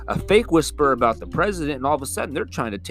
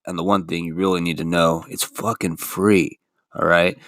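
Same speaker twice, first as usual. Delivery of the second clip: lower, upbeat sponsor pitch; ad read; 7 semitones lower than usual. Acted. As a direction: sell the app like a radio spot. Reads podcast sponsor ad.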